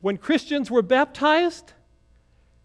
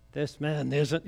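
low grumble under his breath; muttering; 7 semitones lower, 6 dB quieter, slower than usual. Retold or imitated. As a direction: mutter it so only half is heard. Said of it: "he's, he's muttering it".

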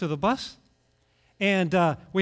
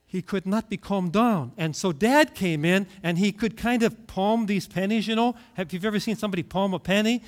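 ordinary delivery

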